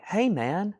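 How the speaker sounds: high, airy [name] voice; [name] impression. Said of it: "my best [name] voice".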